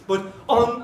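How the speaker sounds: ordinary delivery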